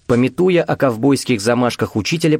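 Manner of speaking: ordinary delivery, nothing marked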